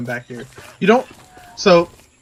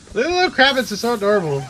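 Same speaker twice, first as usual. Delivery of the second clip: high, tender cooing; 8 semitones higher than usual. Real, not acted. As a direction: gush over a cute pet. Cooing at cute creature.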